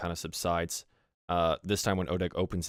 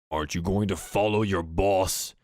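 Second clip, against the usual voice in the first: slow, louder orc voice; gruff orc; 5 dB louder than usual. Acted. Orc torturer sending the goblin off, slow and louder. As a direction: dismiss the lackey.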